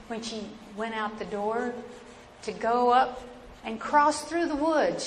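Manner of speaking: ordinary delivery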